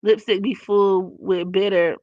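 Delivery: usual speaking voice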